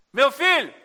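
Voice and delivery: put-on loud, high call to a child; mother calling out. (mãe chamando alto o filho)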